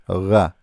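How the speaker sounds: ra with the throaty French r, Arabic ghain; pronunciation demo. Shown as a demonstration. Teacher shows a letter French speakers can say, pronunciation demo. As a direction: sound out ra separately, r made in the throat. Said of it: "have no problem to say it".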